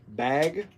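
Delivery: bag said with a long Midwest a; a slow Midwest Bag held as a sample. Shown as a demonstration. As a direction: stretch the vowel in Bag like a Midwesterner. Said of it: "if I say bag, that might sound different to you guys".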